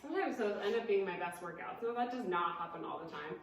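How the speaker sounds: ordinary delivery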